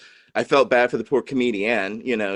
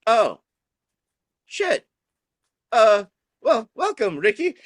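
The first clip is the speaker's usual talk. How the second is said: much higher, louder, caught-off-guard welcome; flustered comedian; 12 semitones higher, 4 dB louder than usual. Retold or imitated. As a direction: play the comic thrown off her set. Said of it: "the comedian's just like".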